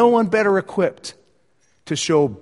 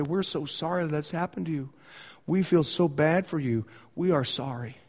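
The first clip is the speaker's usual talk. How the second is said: soft sympathy; 8 dB quieter than usual. Acted. Friends voiced sympathizing.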